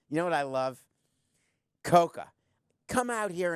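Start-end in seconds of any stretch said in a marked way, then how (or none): 1.8-3.5 loud call to the producer; sudden loud summons. Real, not acted. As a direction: punch the name out loud. Calls out to his producer.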